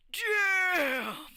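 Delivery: loud, high exclamation of wonder; amazed damn. Retold or imitated. Arrakis hearing it's worth a warship, loud and high. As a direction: blurt it out, dazzled. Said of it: "And Arrakis goes".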